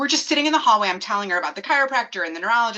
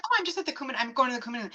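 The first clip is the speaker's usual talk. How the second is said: softer, offhand retold reply; no big deal; 3 semitones higher, 9 dB quieter, much faster than usual. Mimicking own answer to the obstetrician, casually. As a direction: drop it lightly, almost thrown away. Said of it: "No big deal".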